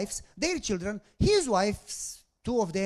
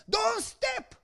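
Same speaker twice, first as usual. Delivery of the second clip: urgent warning shout; 13 semitones higher than usual. Retold or imitated. Bus driver yelling a warning from the road. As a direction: shout it to stop him cold. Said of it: "He says".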